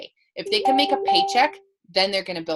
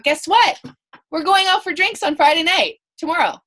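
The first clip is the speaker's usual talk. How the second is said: excited; 8 semitones higher, 6 dB louder than usual. Retold or imitated. Excited invitation quoted.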